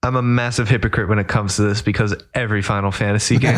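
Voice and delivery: ordinary talk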